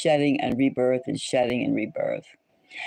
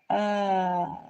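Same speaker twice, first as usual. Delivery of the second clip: weary sigh; 4 semitones higher than usual. Retold or imitated. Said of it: "And I go".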